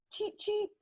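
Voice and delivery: high, soft cheep cheep; high little chick cheeps; chick peeping. Acted. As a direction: peep like a tiny chick. Chick sound.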